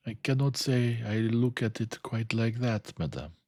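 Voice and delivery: a measured Belgian gentleman; Poirot voice. A narrator's reading.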